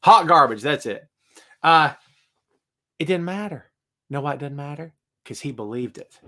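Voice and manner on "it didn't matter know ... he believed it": soft, dropping quieter phrase by phrase; hushed point-making. Real, not acted. Host explaining why Warrior's promos worked, hushed and deliberate.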